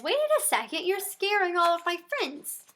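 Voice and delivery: ordinary delivery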